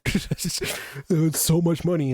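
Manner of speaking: slow, drawn-out mocking whine; slow sarcastic mimicry of a worried producer. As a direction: drag it out, mocking the producer's complaint. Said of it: "if he's like".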